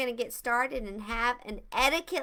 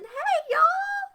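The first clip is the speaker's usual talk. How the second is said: excited squeal; 5 dB louder than usual. Real, not acted. Greeting kids joining the stream.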